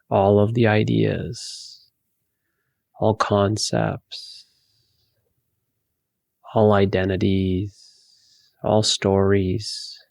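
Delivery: slow, spacious meditation voice; guided meditation. Acted. Teacher guiding listeners to empty their thoughts, guided meditation. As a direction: lead them gently inward, with long pauses.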